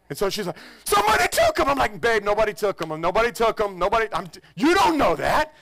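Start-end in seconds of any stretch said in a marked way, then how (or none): 0.8-1.7 very high, loud mock panic; imitating panic. Mother fearing her son was taken, very high panic. 2.0-5.5 high, loud, urgent; calm her down while rattled yourself; agitated reassurance. Retold or imitated. Husband insisting to wife nobody took him, urgent.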